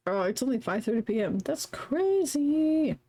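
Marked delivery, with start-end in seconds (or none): 1.4-3.0 slow, high sarcastic drawl; drawn out. Real, not acted. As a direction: stretch it out mockingly. Joking about time.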